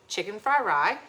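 put-on FRIED RICE as "fry rye" in a Chinese accent; imitated Chinese accent. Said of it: "I am very susceptible to accents".

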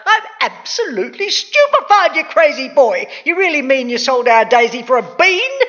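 high, loud, incredulous mother; shrieking outrage, pitched right up; outraged cry. A narrator's reading. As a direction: explode in disbelief at your son. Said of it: "leaped high into the air and cried".

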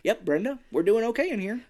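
mock nonchalance; acted casual phone talk. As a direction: play it falsely fine on the phone. Feigned calm phone call, retold.

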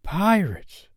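put-on high, thrilled boy's echo; excited cry. A narrator's reading. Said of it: "cried John".

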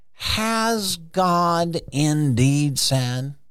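drawn out slowly, rising like a tempter; sly serpent. Retold or imitated. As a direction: stretch it like a sly snake questioning. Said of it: "I don't know if he talked like that".

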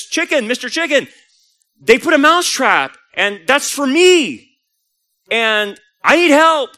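high, loud mouse begging for help; hammed-up desperate cry to a friend; panicked plea. Panicked mouse begs chicken for help.

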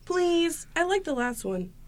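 put-on high pleading little boy; kid voice. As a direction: whine like a young grandson. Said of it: "you're gonna play grandson".

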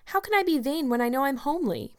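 put-on a high, indignant girl's protest. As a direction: push back, voice rising. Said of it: "protested Anne".

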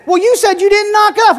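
high, quick, incredulous objection; imitating a pal's protest; challenging companions. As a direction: fire back like a doubting sidekick. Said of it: "And they said".

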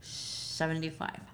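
mouth full. Real, not acted. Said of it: "I'm eating edamame and I'm having trouble with it".

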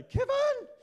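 piercing high yell; movie shout of Kevin. Retold or imitated. Inviting the congregation to yell like the movie, a shrill shout. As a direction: scream the name like the panicked mum. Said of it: "like it does in the movie".

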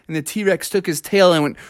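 ordinary delivery, nothing marked

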